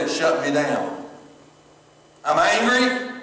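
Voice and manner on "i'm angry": I'm angry with pitch jumping up on angry; heated I'm angry, high on angry; angry flare. Shown as a demonstration. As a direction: let the anger rise in the voice. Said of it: "Do I let it cause me".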